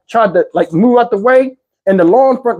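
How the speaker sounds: ordinary talk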